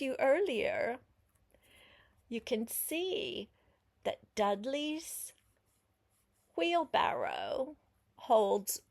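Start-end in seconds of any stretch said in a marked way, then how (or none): none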